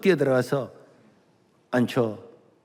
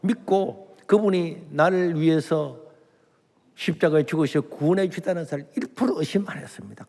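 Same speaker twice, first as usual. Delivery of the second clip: usual speaking voice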